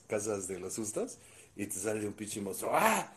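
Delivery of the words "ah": grito de susto. Retold or imitated.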